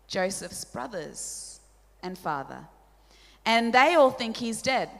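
ordinary talk

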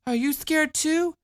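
put-on small girl admitting fear; scared little girl. A narrator's reading. Child voice.

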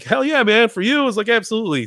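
high-pitched, starstruck yes; over-eager retold reply; eager agreement. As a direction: act out jumping at the chance to help. Eager yes to star's request.

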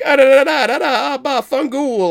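high, loud gibberish Italian; mock Italian ranting. Italian chef answering the sheriff, high mock-Italian rant. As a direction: imitate an irate Italian with nonsense syllables. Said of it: "the chef is Italian".

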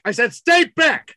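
high, forceful police shout; escalating shouted warning. Acted. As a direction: shout the order insistently, hitting STAY BACK. Cop repeats warning, escalating.

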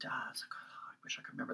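whispered to herself, trying to recall; breathy whisper, searching memory. Real, not acted. Straining to recall store name.

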